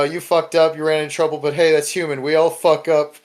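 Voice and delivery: usual speaking voice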